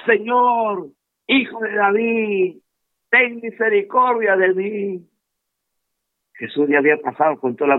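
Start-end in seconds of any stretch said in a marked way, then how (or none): 0.1-5.1 a higher, slow plea to the Lord; a blind beggar crying out to Jesus as he passes. Acted. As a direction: call out to Jesus as a desperate blind man. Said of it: "y él seguía clamando".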